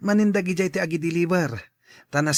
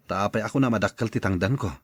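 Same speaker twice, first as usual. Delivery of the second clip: low, deeper put-on man's voice; older man voice; 9 semitones lower than usual. Tata Ben answering the boy in a low voice. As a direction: drop low like an older uncle.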